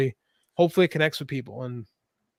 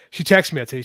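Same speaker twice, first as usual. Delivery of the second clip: ordinary delivery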